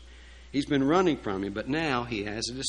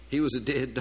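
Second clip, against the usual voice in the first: normal talking voice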